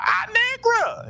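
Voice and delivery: very high, drawn-out film quote; mock movie cry. Host re-enacts a Halle Berry movie scene, high mock cry. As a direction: stretch it into a high wail, imitating the scene. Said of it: "Remember that scene from".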